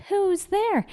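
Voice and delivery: high, startled little mouse cry. A narrator's reading. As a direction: call out like a startled tiny mouse. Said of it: "Mouse cries".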